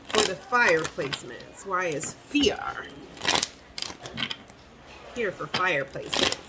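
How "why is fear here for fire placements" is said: soft slow whisper. Shown as a demonstration. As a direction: whisper the question to yourself. Repeats question, whispering.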